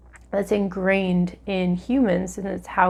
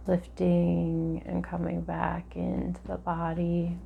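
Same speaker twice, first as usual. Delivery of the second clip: guided meditation; 4 dB quieter than usual. Acted.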